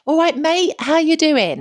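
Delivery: high, loud cheery London greeting. Retold or imitated. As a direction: call out like a matey Londoner. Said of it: "this guy was literally like".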